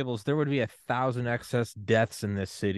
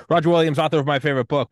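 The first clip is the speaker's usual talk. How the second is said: host intro; 5 semitones higher, 7 dB louder, faster than usual. Real, not acted. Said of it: "introducing next guest".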